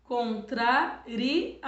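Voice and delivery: Contrarias stretched syllable by syllable, very slow; teacher dragging out Contrarias; very slow syllable demo. Shown as a demonstration. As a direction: spell out Contrarias slowly for the class. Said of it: "já tem que separar".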